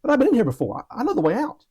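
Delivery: friend Bob. Retold or imitated. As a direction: reassure him, you know the way. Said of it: "And Bob says".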